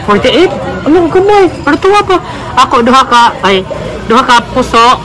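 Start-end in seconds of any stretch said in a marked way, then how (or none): none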